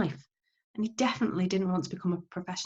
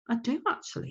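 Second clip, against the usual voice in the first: put-on quiet boy; 6 semitones higher, 4 dB quieter than usual. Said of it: "he said".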